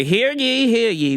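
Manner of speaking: lightly voiced jester announcing news; medieval jester crier. Comic proclaiming decrees like a jester. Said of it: "I always use the voice of, like, a jester from the Middle Ages".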